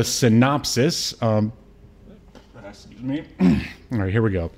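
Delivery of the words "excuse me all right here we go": ordinary delivery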